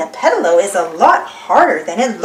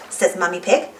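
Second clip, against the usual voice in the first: ordinary delivery, nothing marked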